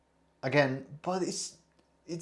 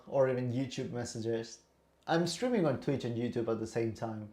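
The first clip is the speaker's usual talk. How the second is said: usual speaking voice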